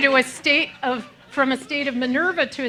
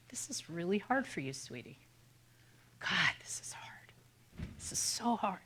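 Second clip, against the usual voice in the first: far softer murmur, half whispered; under the breath; 3 semitones lower, 16 dB quieter than usual. Shown as a demonstration. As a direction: soothe yourself in a near whisper. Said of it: "under the breath to me".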